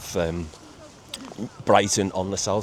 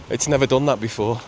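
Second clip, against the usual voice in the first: ordinary delivery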